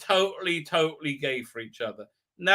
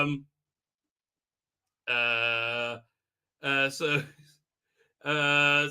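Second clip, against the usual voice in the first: ordinary talk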